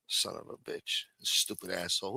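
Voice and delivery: very soft voiceless muttered curse; soft curse while fixing a sore throat. Real, not acted. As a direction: grumble it under your breath. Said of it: "Let me pop a throat lozenge here".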